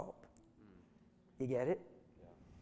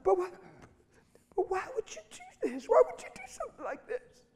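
very high, tearful voice, imitated; acted-out trembling woman, pitched way up; 6 dB louder than usual. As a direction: plead as if about to sob. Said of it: "she's trembling".

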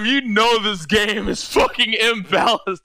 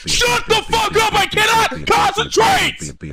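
angry shout; 12 semitones higher, slower than usual. Real, not acted. Streamer yelling at chat to let him concentrate, angry shout. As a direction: roar at everyone to shut up.

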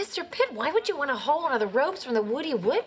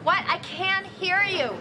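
raised voice, can't hear herself; half-deafened talking loud; 5 semitones higher than usual. Real, not acted. Elaine just back from a loud deli, talking loud. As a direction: speak up over ringing ears. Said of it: "My head is still ringing".

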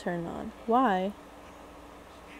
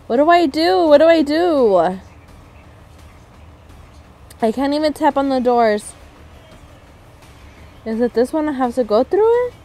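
higher, louder, frantic questions; 5 semitones higher, 7 dB louder than usual. Real, not acted. Trapped by doors, frantic questions to herself. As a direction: plead frantically for an answer.

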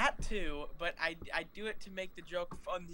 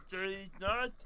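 put-on meme catchphrase voice; funny-voiced impersonation of a viral clip; These Nuts man. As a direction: imitate the prankster delivering his punchline. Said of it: "where he say".

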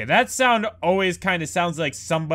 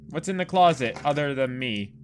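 ordinary talk

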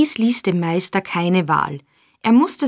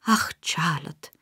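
faint, slow whispered sigh; moaning whisper; 2 semitones lower, 7 dB quieter than usual. A narrator's reading. Exhausted mistress moaning to her maid in a whisper. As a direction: breathe it out weakly, slow. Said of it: "stöhnte die Meisterin".

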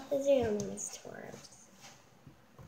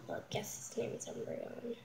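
normal talking voice

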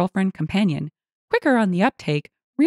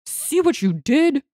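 slow, high-pitched accusation; accusing shout; 8 semitones higher, slower than usual. A narrator's reading. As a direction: blame them in mild outrage. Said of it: "Roy shouted".

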